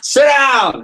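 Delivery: high, loud shout; sudden booming command; bus driver's yell. Acted. Mimics bus driver yelling at riders.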